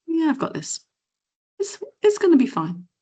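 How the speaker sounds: high, breezy self-assurance; gong voice confidence. Retold or imitated. Speaker voicing her confident inner gong voice, high breezy assurance. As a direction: brush off the doubt with confidence. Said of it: "Gong voice".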